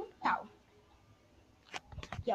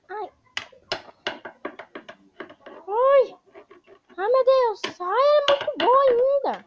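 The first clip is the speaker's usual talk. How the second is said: high, loud whiny villain; 9 semitones higher, 6 dB louder than usual. Acted. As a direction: squeal and fret over the knife. Villain fumbles with knives.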